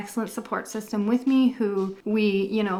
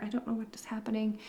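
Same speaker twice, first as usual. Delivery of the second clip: whispered; 8 dB quieter, faster than usual. Real, not acted. Flustered aside during outro.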